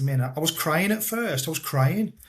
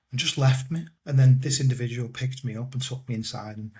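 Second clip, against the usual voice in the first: normal talking voice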